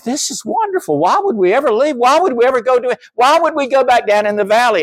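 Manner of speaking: high, eager voices of the awed disciples; dreamy, wanting to stay up there; imagined disciples. Retold or imitated. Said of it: "you can imagine they're saying".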